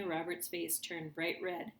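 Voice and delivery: usual speaking voice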